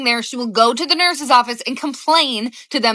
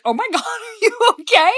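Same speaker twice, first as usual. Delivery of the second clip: high, laughing "Oh my God"; laughing while asking; 9 semitones higher than usual. Retold or imitated. Mom laughing at her daughter in the office, laughing high. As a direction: giggle through the question. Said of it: "I burst out laughing".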